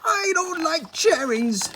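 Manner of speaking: very high, slow, loud roar; the wicked queen refusing cherry cake, slow roar. A narrator's reading. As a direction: roar it like a furious witch. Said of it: "roared the queen".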